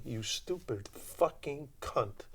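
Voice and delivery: slow, soft whispered snarl; quoting the actor's line in a slow whisper; hushed insult. Retold or imitated. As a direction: breathe the insult out slowly. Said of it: "Joe Mantegna on stage saying".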